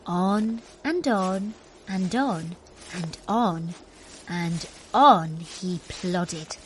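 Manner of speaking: plodding tortoise rhythm. A narrator's reading. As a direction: drag it out like slow steps. Tortoise plods on.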